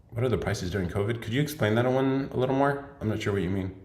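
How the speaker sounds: ordinary talk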